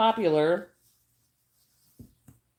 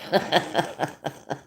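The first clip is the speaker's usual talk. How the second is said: short run of real laughter; amused chuckle; 3 semitones lower than usual. Real, not acted. Owning up to a prank on the students, amused chuckle. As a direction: chuckle at your own little prank.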